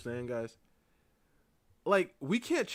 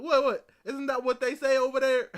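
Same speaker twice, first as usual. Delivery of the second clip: loud, high innocent confusion; imitating Dog; 6 semitones higher, 10 dB louder than usual. Old man defending his word choice, loud puzzled. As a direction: play the clueless old man. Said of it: "You like".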